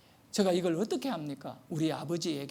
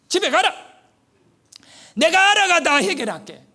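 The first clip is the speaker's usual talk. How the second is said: high, loud command; loud, decisive promise to fix it all; commanding shout; 9 semitones higher, 11 dB louder than usual. Retold or imitated. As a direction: bellow it like the town chief taking charge. Official firmly sends the boy home.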